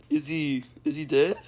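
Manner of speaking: ordinary talk, nothing marked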